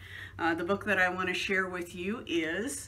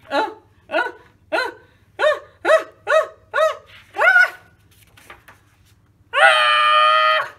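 stammering uhs climbing higher and louder into a cry; rising gasps that explode into a loud high cry; sneeze build-up; 13 dB louder, much slower than usual. Acted. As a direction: build the sneeze until it bursts.

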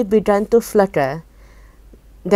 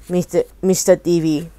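ordinary delivery

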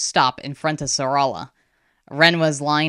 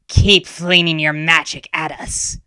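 louder menacing creature voice; growling tiger; 5 dB louder than usual. A narrator's reading. Tiger spirit taunting the defenders, growling. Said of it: "the tiger growled".

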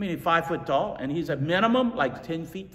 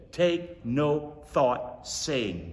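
slow emphasis; much slower than usual. Shown as a demonstration. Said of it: "I wanna say it again".

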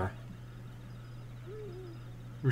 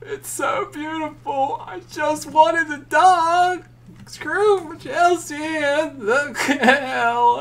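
high, slow hammed-up sob; fake-cry it over losing the dog; mock weeping; 14 semitones higher, 4 dB louder, much slower than usual.

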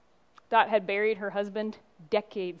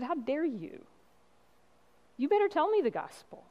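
indignant retold yell; 7 semitones higher than usual. Congregant to her apologizing preacher, retold indignant yell. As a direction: scold indignantly, imitating her, pitch jumping up. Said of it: "And she yelled at me".

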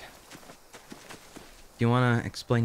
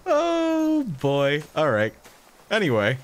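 flustered loud; 6 semitones higher, 4 dB louder, slower than usual. Real, not acted. Recovering after scare, flustered.

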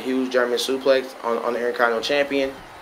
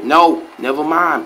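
ordinary talk, nothing marked

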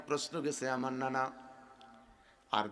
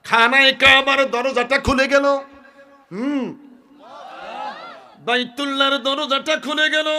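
high, loud, ringing sermon cry; impassioned preaching; 13 semitones higher, 18 dB louder than usual. Acted. Preacher telling crowd of the Kaaba door opening, impassioned. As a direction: proclaim it high and loud with drawn line-ends.

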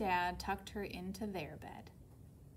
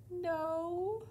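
high, drawn-out yawn through the word; 8 semitones higher, 3 dB louder than usual. A narrator's reading. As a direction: say it through a sleepy child's yawn. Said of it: "Flora said, yawning".

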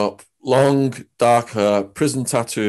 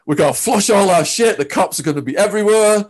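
imitating panic; 9 semitones higher, 3 dB louder than usual. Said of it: "Fish is freaking out".

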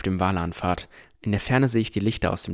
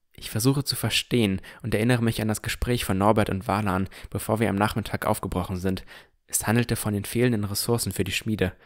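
usual speaking voice

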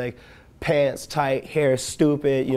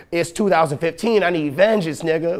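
loud, higher comic demand for revenge; mock outrage; 5 semitones higher, 5 dB louder than usual. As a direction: protest loudly in hammed-up indignation.

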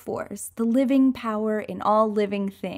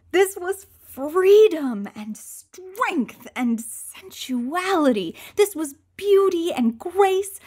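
rapturous; 6 semitones higher than usual. Acted. Ecstatic cat transformation.

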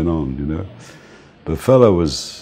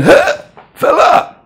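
high, loud shocked yelp of recognition; startled cry; 7 dB louder, slower than usual. Retold or imitated. Attackers realise the driver is [name], startled high cry. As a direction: jump back as you see who it is. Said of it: "They went".

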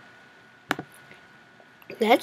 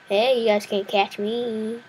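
normal talking voice

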